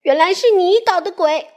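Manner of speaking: a wronged little man accusing Miss Trouble; lightly voiced accusing Mr Small. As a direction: finally catch the culprit. Mr Small confronts her.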